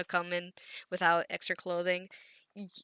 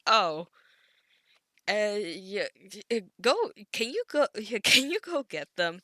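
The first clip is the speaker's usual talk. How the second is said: louder and rushed, tripping over words; flustered; 3 semitones higher, 6 dB louder than usual. Acted. Professor caught out, blurting a fast, louder deflection. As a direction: scramble to change the subject.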